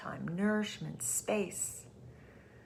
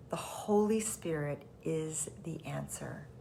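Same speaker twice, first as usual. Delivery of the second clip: whisper. Shown as a demonstration. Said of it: "repeated softly as a whisper".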